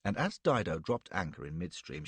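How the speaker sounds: plain reading voice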